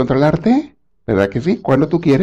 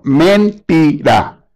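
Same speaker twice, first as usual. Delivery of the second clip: "Mentira" stretched very slowly, high; emphatic MENTIRA; 5 semitones higher, 4 dB louder, much slower than usual. Real, not acted. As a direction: draw out every syllable of "Mentira". Emphatic refutation of an excuse. ("Mentira" enfático, alargando cada sílaba)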